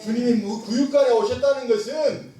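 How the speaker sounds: high, insistent pulpit delivery; forceful preaching. Real, not acted. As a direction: drive the point home to the congregation.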